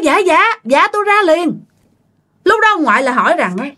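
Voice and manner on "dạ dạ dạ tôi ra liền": high-pitched, eager reply; answering a call. Acted. Grandmother answering a waiting customer from indoors, high calling reply. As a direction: call back that you are on the way. Vendor answers the caller outside.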